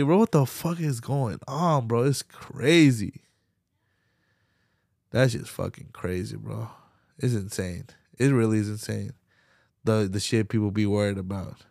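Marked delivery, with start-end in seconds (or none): none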